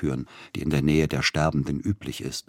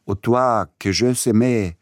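shouted French, higher and louder; delirious shout; 6 semitones higher, 6 dB louder than usual. A narrator's reading. Feverish composer shouts French at the nurse, higher and louder. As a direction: fling the words at the nurse in fever. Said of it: "schrie er ihr zu".

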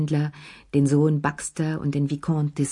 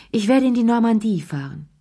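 higher, louder Lea, announcing her trip; 6 semitones higher, 4 dB louder than usual. A narrator's reading. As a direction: say it brightly, raised up. (Lea verkündet laut und hoch ihre Reise)

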